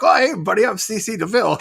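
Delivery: high, bright showbiz comedian voice. Retold or imitated. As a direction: play up a nasal comic showman.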